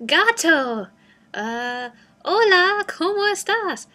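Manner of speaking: loud, halting beginner Spanish: "Gato, uh, hola, ¿cómo estás?"; toddler-level Spanish. Retold or imitated. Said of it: "it sort of sounds like, you know, a two-year-old".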